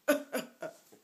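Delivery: high, loud comic sob-laugh; exaggerated crying noise; fake crying. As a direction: break into mock bawling. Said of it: "Second time I cried during a video".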